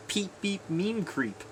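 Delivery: high, slow nonsense words; fake foreign language. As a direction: babble made-up meme words slowly. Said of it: "Fake language".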